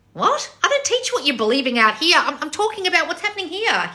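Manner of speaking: high-pitched incredulous correction; exasperated, voice jumping up; losing it. Real, not acted. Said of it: "I'm losing my shit".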